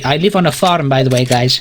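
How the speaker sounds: usual speaking voice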